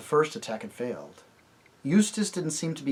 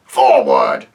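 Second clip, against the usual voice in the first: lower, loud command; commander's order; 10 semitones lower, 13 dB louder than usual. Acted. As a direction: bark it at the troops.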